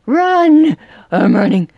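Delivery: loud alarmed yell; real fright, yelling while chased; panicked shout. Real, not acted. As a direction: shout as you flee. Chased by the killer.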